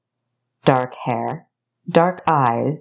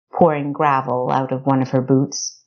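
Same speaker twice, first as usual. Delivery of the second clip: ordinary delivery, nothing marked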